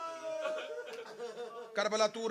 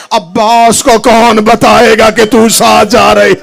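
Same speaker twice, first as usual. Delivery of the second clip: loud, higher wailing call, slow; lament cry; 5 semitones higher, 18 dB louder than usual. Acted. Mourners' climax lamenting Husain, loud wailing cry. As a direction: wail Husain's name out.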